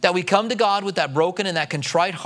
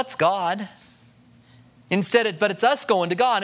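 normal talking voice

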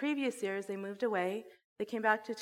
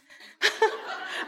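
shocked high laugh; 13 semitones higher, 7 dB louder than usual. Real, not acted. Reacting to shocking belief.

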